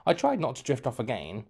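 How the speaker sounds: usual speaking voice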